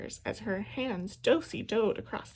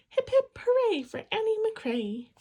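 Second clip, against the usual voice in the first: high, loud cheer for Annie; 12 semitones higher, 4 dB louder than usual. A narrator's reading. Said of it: "she cheered as she always did".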